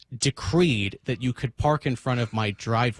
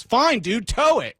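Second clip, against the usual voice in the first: higher, mocking dismissive dude voice; mock bro retort; 9 semitones higher, 4 dB louder than usual. Van driver snapping back in a mock bro voice. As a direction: toss it back like a sulky bro.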